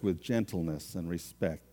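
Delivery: soft gentle read; gentle and respectful. Shown as a demonstration. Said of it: "I gotta watch my tone".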